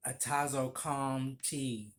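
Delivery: spelling out the tea name slowly; drawn out like reading a menu item; slow, careful order. Shown as a demonstration. Barista recalling the order, slowly naming the tea. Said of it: "This how long ago that was".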